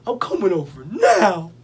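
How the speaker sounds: imitated sister, loud and insistent; mock-urgent phone voice. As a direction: stretch the last word high and loud. Voicing sister's urgent phone reply.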